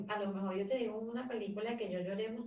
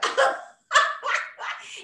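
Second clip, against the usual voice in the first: much higher, louder eerie cackling laugh; mock possessed cackle; 6 dB louder than usual. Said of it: "me convierto en la niña del exorcista".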